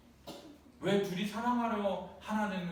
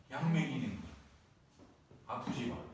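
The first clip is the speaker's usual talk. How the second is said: usual speaking voice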